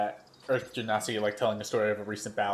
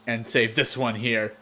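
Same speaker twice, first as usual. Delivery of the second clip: loud, slow bragging war story; a swaggering warrior hamming up a bloody tale; boastful warrior; 2 semitones higher, 5 dB louder, slower than usual. Acted. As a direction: boom it out like a proud fighter reliving a kill. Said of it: "the Earth Genasi in the middle says".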